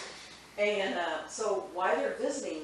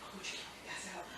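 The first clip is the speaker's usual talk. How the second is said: faint whisper; 13 dB quieter than usual. Retold or imitated. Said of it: "And I'm like".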